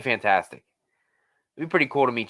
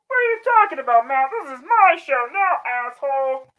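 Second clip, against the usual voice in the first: much higher, far-off voice, bratty and pushy; bratty intruder character. Acted. Intruder character grabbing the stream from Matt, bratty. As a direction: shove your way onto the show like a brat.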